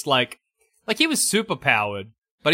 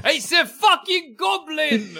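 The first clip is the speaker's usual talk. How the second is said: cockney shout; 12 semitones higher, slower than usual. Retold or imitated. Spider-Man in cockney voice.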